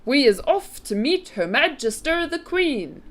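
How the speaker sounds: high, loud giant's announcement; rallying cry. A narrator's reading. BFG heading off to meet the Queen, excited cry. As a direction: proclaim the adventure grandly. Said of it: "cried the B F G".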